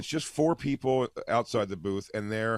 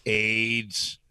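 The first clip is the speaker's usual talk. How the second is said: low, drawn-out single-word impression; botched impression, lowered voice; Charlie Sheen; 4 semitones lower than usual. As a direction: stretch the word out low, after freezing. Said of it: "it's Charlie Sheen I'm doing".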